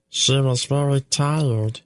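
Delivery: high, slow, solemn creature voice; slow sad fenling. Acted. Tupik tells of Vordai's death, high, slow and solemn. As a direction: speak slowly of the dead.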